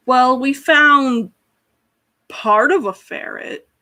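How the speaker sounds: slow reluctant reveal. Acted. Skekvar breaks bad news.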